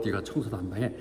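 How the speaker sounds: low, soft mock order; deadpan assigning. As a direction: quietly pass the chore back. Answer to a complainer.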